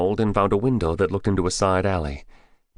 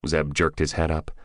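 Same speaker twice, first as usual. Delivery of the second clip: plain reading voice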